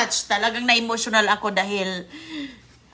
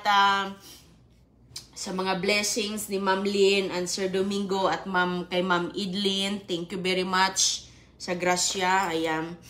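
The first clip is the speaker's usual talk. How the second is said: ordinary talk, nothing marked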